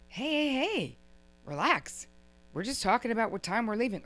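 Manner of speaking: mock-innocent, starting high then easing down; playing the victim. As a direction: act wronged and tell them to relax. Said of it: "you get to be the victim".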